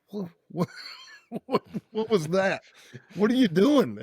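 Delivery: high, startled "what was that?" with a half laugh; baffled. Retold or imitated. To a stranger spewing his rehearsed backstory, baffled and high. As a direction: react like someone just unloaded their whole rehearsed life story on you.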